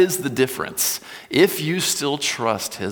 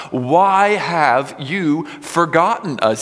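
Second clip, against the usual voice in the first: loud, high, slow accusing cry; shouting at God; 7 semitones higher, 5 dB louder, slower than usual. Acted. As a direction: hurl the question at God with all you've got. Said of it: "he is shouting at God".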